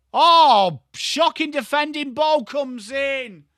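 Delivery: a loud gasp of disbelief, then high and slow; shocked outcry. Real, not acted. Streamer reacting to shocking defending, high dismayed outcry. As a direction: cry out at the awful defending.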